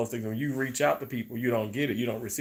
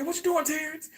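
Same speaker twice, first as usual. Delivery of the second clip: high, mock nosy friend asking; high teasing imitation of a friend; nosy caller. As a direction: chirp it like a curious friend checking in. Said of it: "People say".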